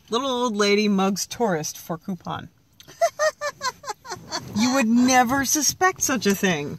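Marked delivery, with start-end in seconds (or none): 3.0-4.5 high laugh. Real, not acted. Joke about old lady mugger.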